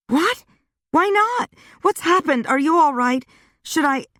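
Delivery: put-on high, excited girlfriend voice; a bubbly friend bursting with questions; Wanda voiced high. A narrator's reading.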